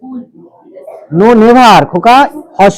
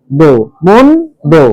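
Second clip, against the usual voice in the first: মন and দো set apart by pauses; syllable by syllable; 5 semitones lower, slower than usual. Shown as a demonstration. Teacher splits the word for the class, separated syllables. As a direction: leave a gap between মন and দো. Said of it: "হসন্ত কিন্তু".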